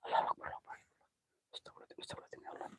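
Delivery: hushed, breathless takbir; frightened whisper of Allahu Akbar; fearful whispered prayer. Real, not acted. Praying in fear at haunted spot.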